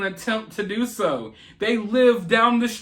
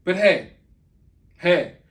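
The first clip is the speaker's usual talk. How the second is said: ordinary talk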